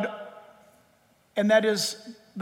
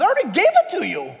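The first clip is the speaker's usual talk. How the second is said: higher, quicker voice of God, a little amused; God's reply; 7 semitones higher, faster than usual. Retold or imitated. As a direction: play God answering lightly, a touch brisk. God answers from the cloud.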